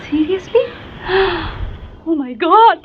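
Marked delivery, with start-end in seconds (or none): 0.7-2.0 a sharp thrilled gasp; excited gasp. Real, not acted. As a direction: gasp in delighted surprise. Thrilled by Barishal speaker.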